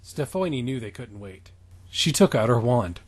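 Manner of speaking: ordinary talk, nothing marked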